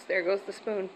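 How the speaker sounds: usual speaking voice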